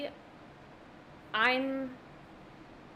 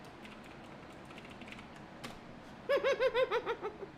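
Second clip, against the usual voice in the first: silent breathy high laugh; high wheezing laugh; 12 semitones higher, 5 dB quieter than usual. Real, not acted. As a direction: laugh without voice, high and airy. Laughs at game moment.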